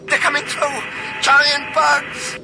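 panicked, very high-pitched yell; screaming. A narrator's reading. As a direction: shriek a warning over the radio. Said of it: "screaming across all channels".

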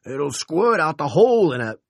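high, loud alarmed cry, cut off. Acted. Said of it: "Fern cried".

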